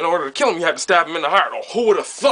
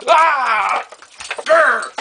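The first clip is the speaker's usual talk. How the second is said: a high, loud hammed-up yell and growl; mock battle shout; 7 semitones higher, 4 dB louder than usual. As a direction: barge in with a fake war cry and growl. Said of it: "she broke in like this".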